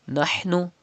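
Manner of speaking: nahnu with a breathy ha from mid throat. Shown as a demonstration. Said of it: "Pronounce ha from the middle part of your throat".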